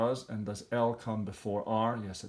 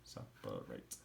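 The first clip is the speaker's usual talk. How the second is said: whispered; 13 semitones lower, 15 dB quieter, slower than usual. Shown as a demonstration. Teacher whispering Separate after saying it aloud. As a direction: whisper Separate low and breathless. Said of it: "three syllable split".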